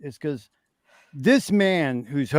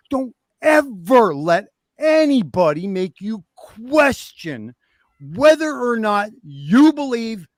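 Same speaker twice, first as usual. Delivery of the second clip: rallying; 4 semitones higher than usual. Real, not acted. Rallying the chat, preacher-style.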